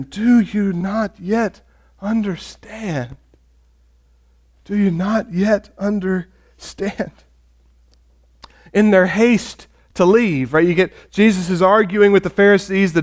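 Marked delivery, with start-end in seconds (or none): 0.0-7.1 drawn-out, deliberate question; measured, weighty disappointment read aloud; slow, pointed reproach. Acted. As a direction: say it very slowly, pausing between the words. Jesus's final reproach, read out.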